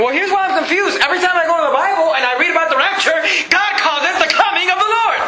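exasperated preacher driving the point home; impassioned preaching. Real, not acted. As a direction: preach it high and insistent, building to the point. Fired-up rebuttal to the critic.

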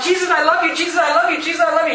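high-pitched repeated gushing; mock adoration. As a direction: gush it over and over like empty words. Mimicking empty repeated professions of love.

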